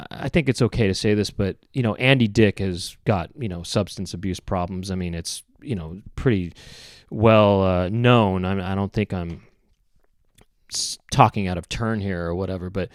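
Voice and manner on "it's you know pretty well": normal talking voice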